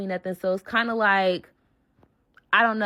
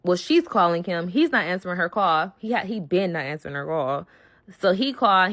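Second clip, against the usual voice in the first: ordinary delivery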